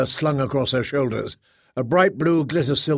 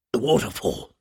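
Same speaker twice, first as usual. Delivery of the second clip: lower, grim echo without breathlessness; bitter repetition; 4 semitones lower than usual. A narrator's reading. Bitter speaker echoes her mate's news, low and grim. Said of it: "the bitter speaker repeated".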